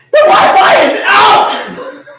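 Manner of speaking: put-on a child's loud, slow, laughing wail of despair; panicked shout. Child wailing to mom that the Wi-Fi is out, loud and laughing. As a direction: belt it out like the world is ending. Child screams about Wi-Fi outage.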